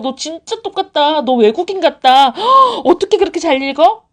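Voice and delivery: high, over-the-top amazed praise with a gasp; gushing mom demo. Shown as a demonstration. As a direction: marvel at the child, building to a gasp. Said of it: "이러시면 되잖아요". (과장되게 감탄하며 칭찬하는 엄마 말투)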